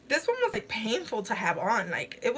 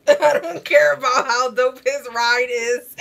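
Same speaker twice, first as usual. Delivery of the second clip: louder, laughing through a quoted movie line; laughing quote; 8 dB louder than usual. Retold or imitated. Streamer quoting the film to viewers, laughing hard and louder. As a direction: crack up while reciting the line.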